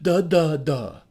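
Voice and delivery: sarcastic duhs fading away; mocking duhs trailing off; series of duh. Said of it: "that requires a series of duh".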